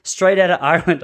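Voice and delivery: playful imitation of an Irish accent. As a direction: lightly put on an Irish accent. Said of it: "imitate the Irish accent".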